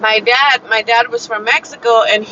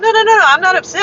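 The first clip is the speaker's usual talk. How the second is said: rapid, higher-pitched retold protest; quick reassurance; 10 semitones higher, much faster than usual. Reassuring a coworker who fears she upset her, hurried. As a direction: rush to wave away the worry.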